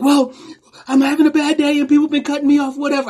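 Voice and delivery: high, fast, exasperated woman's voice; frustrated woman driver. Retold or imitated. Angry driver explains bad day.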